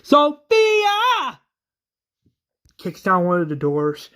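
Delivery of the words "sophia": much higher, louder scream of a name; frantic yell of a name; desperate scream. Retold or imitated. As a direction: scream for the lost girl. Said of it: "He is screaming Sophia's name".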